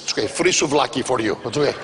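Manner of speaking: vendor voice. Retold or imitated. Vendor voice continues.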